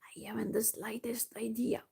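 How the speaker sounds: soft unvoiced whisper. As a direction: breathe it out in a whisper. Gnome answers, whispered.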